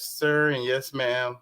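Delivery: ordinary talk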